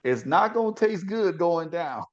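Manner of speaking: high, drawn-out preacher's cadence; preaching exhortation. Real, not acted. Co-host urging courage to confront a friend, preaching it high and slow. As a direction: stretch key words and leave weighty pauses.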